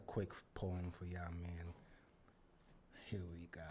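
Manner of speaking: whispering into the mic. Real, not acted. Poet whispering to the audience before the poem. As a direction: hush it like a secret.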